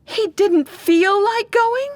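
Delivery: slow, high-pitched disbelief, rising at the end; incredulous outrage. Acted. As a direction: echo the excuse back, appalled. Parent appalled by son's excuse.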